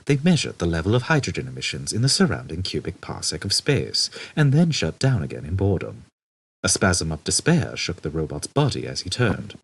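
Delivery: usual speaking voice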